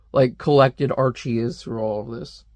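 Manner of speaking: usual speaking voice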